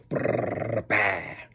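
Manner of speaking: rolled brrr, then a bah; mouth-made whirr with a finishing burst; show-off whirring noise. Acted. Showing off with a Rubik's Cube, imitated whirring flourish. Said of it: "I could be all like".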